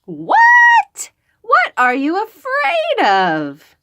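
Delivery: very high, loud startled question; surprised mother. Acted. Mother startled her daughter is scared, high and loud. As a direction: gasp it out in surprise.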